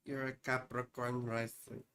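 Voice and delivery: put-on low, soft Russian accent. Telling Pamela her rising sign, playful Russian accent. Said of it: "want to do Russian accent".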